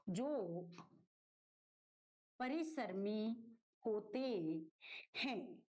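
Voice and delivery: slow, with a pause after each word; measured teacher dictation, broken into single words. Shown as a demonstration. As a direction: dictate it piece by piece for writing.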